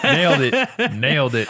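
loud praise through real laughter; laughing approval. Real, not acted. Co-host cracking up at the bad British accent, laughing. Reacting to co-host's joke accent.

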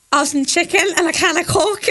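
Scouse accent. Shown as a demonstration.

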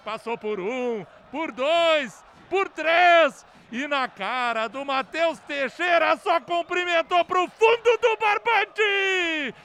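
climbing, slow, shouted recap; explosive goal commentary. Real, not acted. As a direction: build each word to a roar.

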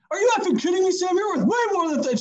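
high-pitched, outraged mom telling off; reaming someone out. Retold or imitated. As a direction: imitate a mother's indignant scolding. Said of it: "she reamed me".

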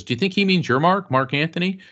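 high, quick retold question; excited investigator. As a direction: rush it out in eager wonder.

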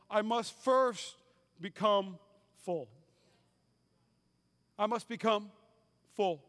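slow it down, word by word; deliberate preacher's pause; slow, weighty emphasis. Acted. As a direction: lean on each word to land it. Preacher stressing being full.

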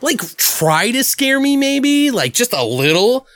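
retold exasperation, stretching the key words; mock her fed-up critique; imitating unimpressed girlfriend. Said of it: "she was like".

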